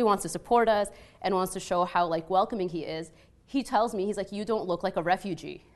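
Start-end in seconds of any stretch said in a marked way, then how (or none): none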